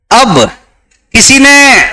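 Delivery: usual speaking voice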